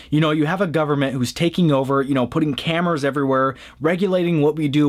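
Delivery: usual speaking voice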